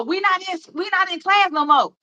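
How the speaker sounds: mocking her friends. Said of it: "You know".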